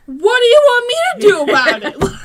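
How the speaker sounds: high, loud, laughing fed-up question; laughing exasperation. Retold or imitated. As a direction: throw up your hands, laughing. Mom fed up with child.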